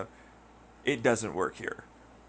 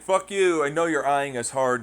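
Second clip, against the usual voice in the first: mock threat; 4 semitones higher, 6 dB louder than usual. Locals threatening cops.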